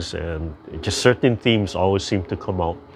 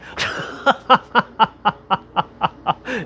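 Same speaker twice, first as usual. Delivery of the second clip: high giggling laugh; child's giggle; 13 semitones higher than usual. Retold or imitated. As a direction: laugh like a delighted little girl. Granddaughter laughing at bath time.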